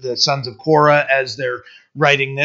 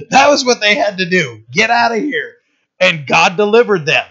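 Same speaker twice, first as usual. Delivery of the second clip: fervent preaching; 5 semitones higher than usual. Acted. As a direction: preach it with growing fire. Preaching builds to a climax.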